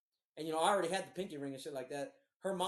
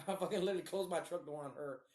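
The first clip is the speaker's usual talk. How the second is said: ordinary delivery, nothing marked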